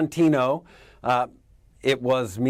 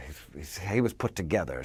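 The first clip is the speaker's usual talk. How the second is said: ordinary delivery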